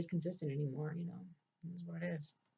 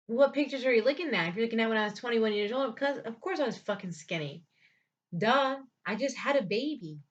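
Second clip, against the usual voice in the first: retold angry retort; 4 semitones higher, 6 dB louder than usual. Retold retort to mocking in-laws.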